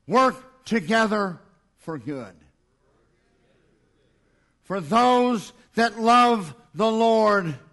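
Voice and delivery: slow, weighty recitation for the crowd to echo; measured call-and-repeat reading; recited scripture. Shown as a demonstration. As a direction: lead the congregation line by line. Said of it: "repeat them with me".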